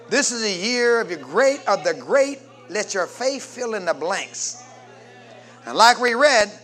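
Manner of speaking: ordinary talk, nothing marked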